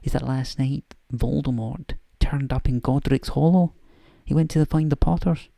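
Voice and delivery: put-on a slightly overdone Scottish accent, briskly; Scottish McGonagall. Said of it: "almost bad Scottish accent".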